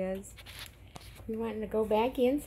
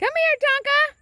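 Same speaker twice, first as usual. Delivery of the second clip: much higher, louder call of the dog's name; calling the puppy; 10 dB louder, slower than usual. Real, not acted. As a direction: call him in across the yard.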